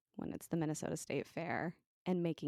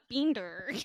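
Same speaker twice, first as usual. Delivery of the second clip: exaggerated American "Beander", higher and louder; mock American accent with a hard r; imitated American accent; 6 semitones higher, 5 dB louder than usual. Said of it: "That's how Sean does my accent".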